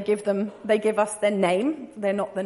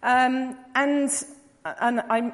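usual speaking voice

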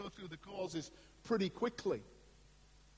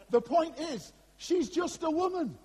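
mock protest; 8 semitones higher, 5 dB louder than usual. Real, not acted. Joking politically incorrect aside.

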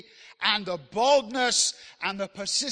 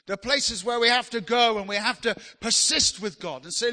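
ordinary talk

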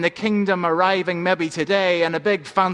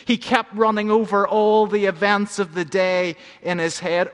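normal talking voice

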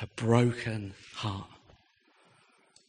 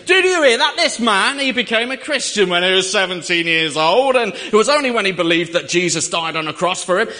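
higher, loud imitated proclamation; loud carriage announcement; 6 semitones higher, 9 dB louder than usual. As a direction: announce it to everyone on the train. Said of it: "really loud voice for the benefit of the whole carriage".

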